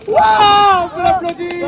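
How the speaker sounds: high, loud wow; excited wow. Acted. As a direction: cheer him on with a big wow. Cheers the volunteer.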